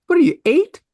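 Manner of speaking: high, incredulous scolding question; scornful. Acted. Host scolding grown-ups who say snack, scornful.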